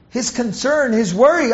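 ordinary talk, nothing marked

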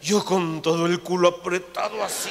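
usual speaking voice

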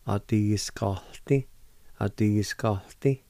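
"A-ti-skalti" said slowly with each syllable clear; slow model word. Shown as a demonstration. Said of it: "It'll sound something like this".

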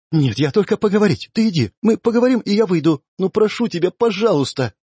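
put-on pleading girl. A narrator's reading. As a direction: plead with him to let her stay behind. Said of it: "попросила Амалия Урти". (девушка высоким голосом упрашивает, почти умоляет)

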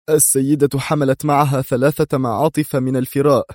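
high, anxious informant; strained high voice of a scared man; nervous confession. A narrator's reading. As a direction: give up the information under pressure. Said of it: "ازدرد لعابه في صعوبة وقال".

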